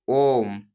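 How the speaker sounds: ओम held long; drawn-out Om. Shown as a demonstration. Teacher showing a prolonged vowel, drawn out. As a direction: chant ओम slowly, stretched. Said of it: "ओम ज्यादा समय लग रहा है".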